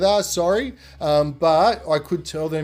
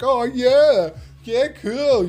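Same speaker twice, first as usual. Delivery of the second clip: much higher, louder cocky whoop; cocky cheer; 10 semitones higher, 6 dB louder, much slower than usual. Retold or imitated. Naked boys crowing at cops who drive past, loud and cocky. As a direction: crow it like a proud show-off.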